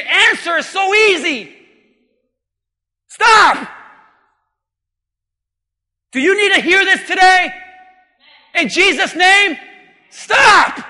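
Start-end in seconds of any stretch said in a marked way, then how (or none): 3.1-3.7 high, loud one-word command; preacher shouting a halt to the congregation; shouted command. Real, not acted. 10.1-10.8 high, loud one-word command; preacher shouting a halt to the congregation; shouted command. Real, not acted.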